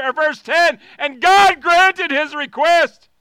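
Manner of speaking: shouted, rising prayer at full volume; fiery pulpit prayer; preaching at full force. Acted. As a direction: cry out to God to stretch you.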